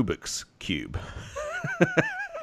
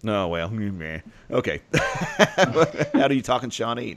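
ordinary delivery

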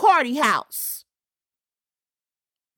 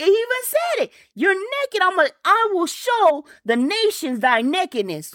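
much higher, fervent preaching cry; preaching at full force; 7 semitones higher than usual. Acted. Preacher crying God's words to the congregation, at full force. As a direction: lift the voice and cry it out to the church.

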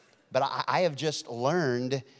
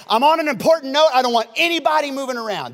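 high, loud pulpit command; stern preacher; 11 semitones higher, 10 dB louder than usual. Retold or imitated. Pastor warning the congregation to stay seated, loud preacher voice. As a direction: call out over the congregation like a preacher. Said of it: "the pastor was preaching".

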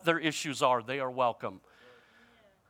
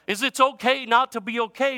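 higher and louder, "okay" rising; loud slogan; 7 semitones higher, 5 dB louder than usual. Shown as a demonstration. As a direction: proclaim it, letting "okay" rise. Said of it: "slogan first said loud and high".

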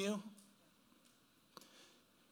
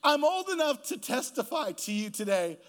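preaching; 4 semitones higher, 4 dB louder than usual. Acted. Sermon climax, slowed.

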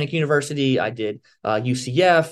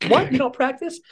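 shocked question; 10 semitones higher than usual. Retold or imitated. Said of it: "Your look on your face is pure shock".

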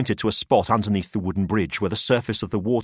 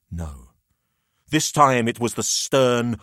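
ordinary delivery

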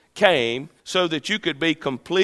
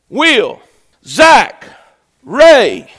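loud, higher calls of each name; names ringing out one by one; calling out names; 6 semitones higher, 13 dB louder, much slower than usual. Acted. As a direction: call each person by name. God calling names personally.

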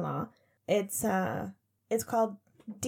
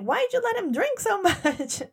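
mock yell, pitched way up; retold yell; 10 semitones higher, 4 dB louder than usual. Mother mock-yells at her son about dad drinking. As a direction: throw the question at her son, high and loud. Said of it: "And I yelled at my son".

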